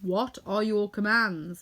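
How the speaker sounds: slow, formal monkey king. A narrator's reading. Monkey King asks Dorothy for orders: slow formal. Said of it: "inquired the King of the Monkeys".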